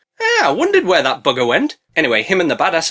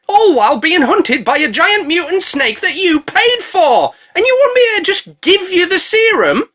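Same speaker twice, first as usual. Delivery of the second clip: higher and louder, rising to a shout; building indignant rant; 10 semitones higher, 4 dB louder than usual. Acted. Blue Tit ranting at Gimli, building fury. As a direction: list every betrayal, getting angrier.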